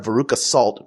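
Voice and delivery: usual speaking voice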